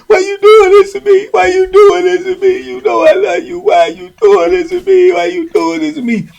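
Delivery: high-pitched mock wailing plea; imitated crying. Mimicking the crying man asking why, high tearful pleading. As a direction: whine it like a man in tears. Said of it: "He literally crying".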